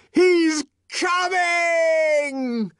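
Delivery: very slow, high, drawn-out holler; long ecstatic bellow in a high pitch; yell. A narrator's reading. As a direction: yell it high, stretching both words. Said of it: "yelled Jim Bokanovsky".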